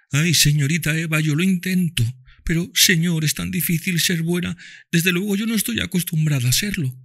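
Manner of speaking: put-on high girl voice, tearful; Topsy answers Ofelia through tears, high girl voice. A narrator's reading. Topsy replies.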